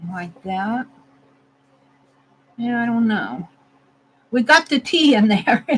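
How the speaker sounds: ordinary talk, nothing marked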